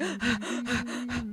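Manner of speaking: sharp relieved gasp. Retold or imitated. Teens bursting into the bright store, gasping with relief. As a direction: catch your breath in relief.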